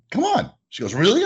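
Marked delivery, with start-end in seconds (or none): 0.1-0.5 much higher, eager come on; excited invite. Retold or imitated. Owner eagerly invites a visiting customer over. 0.9-1.2 higher, louder really; surprised customer. Retold or imitated. Customer surprised she may visit.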